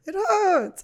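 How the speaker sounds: put-on high childish whine; whiny toddler. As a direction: whimper like a hurt kid. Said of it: "and it goes".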